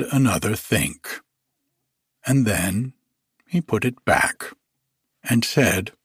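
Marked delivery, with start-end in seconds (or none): none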